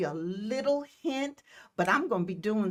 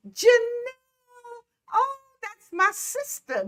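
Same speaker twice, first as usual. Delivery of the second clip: much higher, louder happy cry of the name; excited call; 14 semitones higher, 4 dB louder than usual. Real, not acted. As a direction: call out to your sister, thrilled. Spots her sister in the chat.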